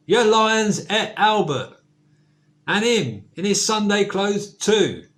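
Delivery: loud, high, outraged father; indignant shout. Acted. Pa shouting at the zoo keeper, loud and outraged. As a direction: bellow it at the keeper, offended. Pa complaining to manager.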